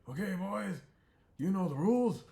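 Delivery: high, loud announcer ref; referee voice. Acted. The porcupine ref asks the fighters, high and loud. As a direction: call out like a fussy little referee.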